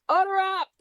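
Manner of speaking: high called-out "Order up!"; mock shout. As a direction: call it out loud and high like a cook. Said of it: "and Preacher shouts".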